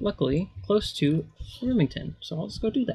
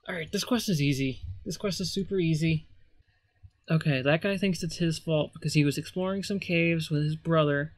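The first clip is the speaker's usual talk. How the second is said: ordinary talk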